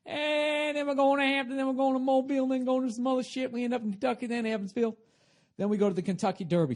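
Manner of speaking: loud, high tour-date roll call; hyped-up announcing. Shown as a demonstration. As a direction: belt out the cities like a hype man.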